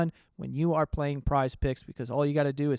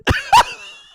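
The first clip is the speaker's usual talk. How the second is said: loud, high burst of laughter; high shrieking laugh; 13 dB louder than usual. Real, not acted. Laughing at the text's punchline, high shriek. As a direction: let a shrill laugh burst out.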